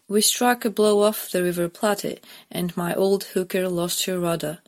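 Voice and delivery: usual speaking voice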